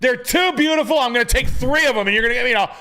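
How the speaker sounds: high, fussy put-on realtor voice; realtor impression. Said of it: "And he's like".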